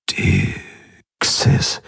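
faint, halting whisper; groggy and weak. A narrator's reading. Patient remembers the attackers, weak and halting. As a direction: force out the words feebly.

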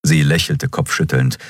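plain reading voice

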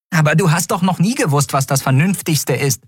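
high, quick comeback; indignant retort. Acted. Fairy contradicting her captain, indignant. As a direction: throw it back at her, pitched high.

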